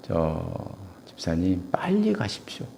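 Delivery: hushed, urgent whisper. Retold or imitated. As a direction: lean in and whisper the advice. Whispered advice to deaconess. (집사님께 속삭이는 조언)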